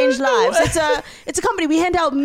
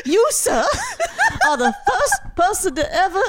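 laughing, higher, overwrought gratitude; mock heartfelt speech; 10 semitones higher than usual. Friend pretending a stranger is the first to praise her, laughing. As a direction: gush through laughter like a movie moment. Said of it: "look into my eyes and say".

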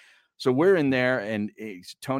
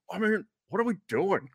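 imitating Nahagian; 11 semitones higher than usual. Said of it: "I felt like Nahagian".

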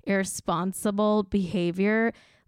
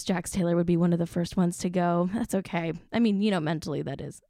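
ordinary talk, nothing marked

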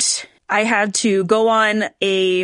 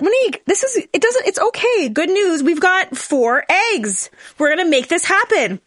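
reassuring doctor; 7 semitones higher than usual. Retold or imitated. Doctor reassuring her in retelling.

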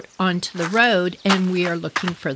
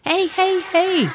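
mock yelling; 11 semitones higher, slower than usual. Narrator hailing the ranch house, high mock yell. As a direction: call for attention in a raised pitch.

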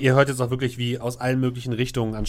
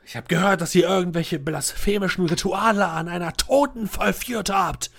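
accusing interrogation; 7 semitones higher than usual. Acted.

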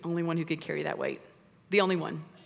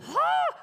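a high, loud startled shriek; the shepherds jump in fright; startled cry; 5 dB louder than usual. Retold or imitated. Shepherds terrified by angels.

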